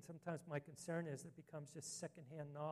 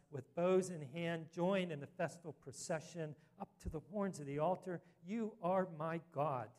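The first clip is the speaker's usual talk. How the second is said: full-force preaching; 5 dB louder than usual. Acted. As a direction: declare each line to the congregation like a call to worship. Proclaims psalm at full force.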